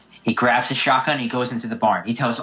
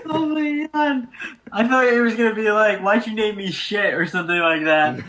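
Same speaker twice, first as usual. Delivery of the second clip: laughing while talking; 6 semitones higher than usual. Real, not acted. Friend explaining his guess through real, tearful laughter. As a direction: still wheezing with laughter as he explains. Still laughing after joke.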